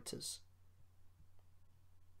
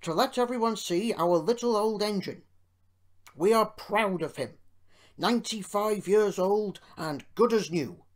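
loud, hearty public address; big projected speech-making voice; proud speech; 5 semitones higher, 8 dB louder than usual. A narrator's reading. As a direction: declare it proudly to the crowd. Owner's proud speech to the crowd.

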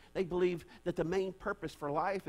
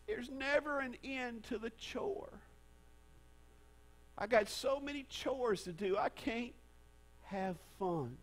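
put-on higher, slow, soft whimpering child; whiny little girl; 6 semitones higher, 3 dB quieter than usual. Pastor voicing the little girl complaining of chores, high and soft. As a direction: whimper it like a tired toddler. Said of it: "She says".

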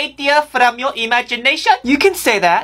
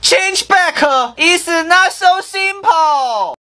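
slow dramatic; 4 semitones higher, slower than usual. Acted. Slow exaggerated reply.

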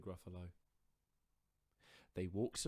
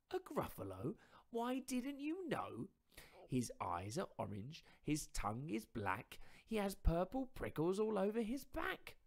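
higher, louder mouse voice, spinning a tall tale; boastful little mouse; 12 semitones higher, 6 dB louder than usual. Acted. Mouse inventing a monster to frighten fox, higher and louder.

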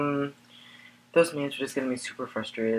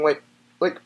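hammed-up crying; 6 semitones higher, 7 dB louder than usual. Fake emotional breakdown.

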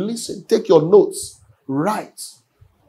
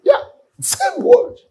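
high mock voice; slower than usual. High odd voice aside.

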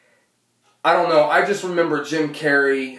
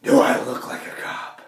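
low, whispered Batman growl; gruff whispered Batman; 5 semitones lower than usual. Retold or imitated. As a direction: rasp it low in a whisper like Bale.

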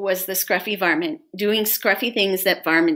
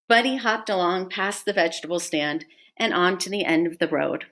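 plain reading voice

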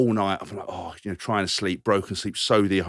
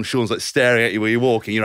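usual speaking voice

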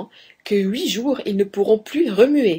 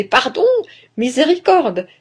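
higher pleading cry, mildly acted; cried plea for mercy; 6 semitones higher, 4 dB louder than usual. A narrator's reading. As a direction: beg for mercy, voice rising. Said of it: "plus il criait". (supplication criée, un peu jouée)

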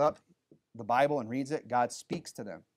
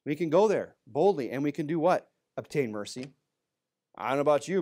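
normal talking voice

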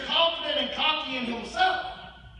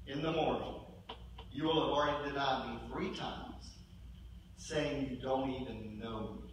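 Jesus quietly warning Peter, softer and lower; 8 semitones lower, 8 dB quieter than usual. Retold or imitated. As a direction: warn him gently and gravely. Voicing Jesus softly.

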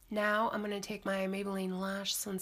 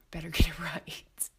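laughing through it; 3 semitones lower, 5 dB louder than usual. Real, not acted. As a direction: repeat the line breaking into a laugh. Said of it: "second take, laughing".

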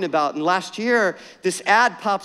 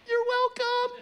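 much higher, sweet mock wife voice; imitating wife; 4 dB quieter, slower than usual. Pastor imitating his wife handing over the gift, high and sweet. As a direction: play the wife, high and sugary. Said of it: "she's like".

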